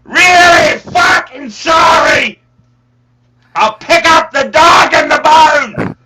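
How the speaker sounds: loud, high, hammed-up Cockney; Cockney accent. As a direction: shout a mock Cockney apology, drawing out the words. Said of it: "best Cockney accent".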